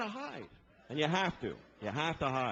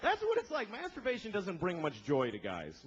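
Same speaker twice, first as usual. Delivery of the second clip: ordinary talk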